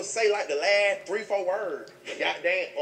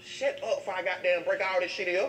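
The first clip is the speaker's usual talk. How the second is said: ordinary talk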